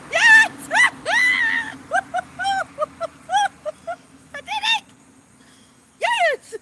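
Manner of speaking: high, loud whoops with laughter; ecstatic shout. Real, not acted. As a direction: yell it in laughing triumph. Celebrates flying the drone.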